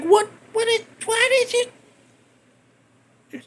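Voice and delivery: high, stretched-out disbelieving question; shocked. Retold or imitated. Imitating the girl staring at him after getting hit, shocked and slow.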